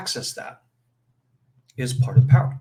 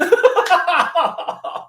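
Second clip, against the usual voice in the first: laughter; 7 dB louder than usual. Real, not acted. Laughs after Ant King quote.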